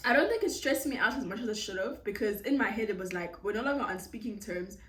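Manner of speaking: normal talking voice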